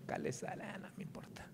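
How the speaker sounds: barely voiced, whispered aside; dismissive whisper. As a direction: whisper it as a careless believer would.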